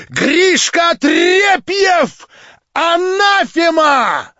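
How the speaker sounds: very high, loud shriek; shrieking with laughter. A narrator's reading. Marya shrieks curses after fleeing Stavrogin. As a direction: scream it after him into the dark. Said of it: "прокричать с визгом и с хохотом".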